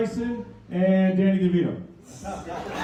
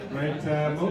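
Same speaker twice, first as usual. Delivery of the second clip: ordinary talk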